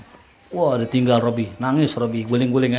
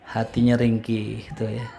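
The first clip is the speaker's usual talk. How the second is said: ordinary talk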